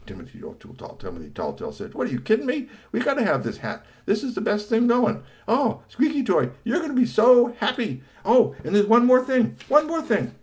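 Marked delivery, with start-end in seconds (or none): 1.9-10.3 very high, louder excited gushing; thrilled high voice; excited squeal. Acted. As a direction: squeal with delight over the hat. Character excited about the hat.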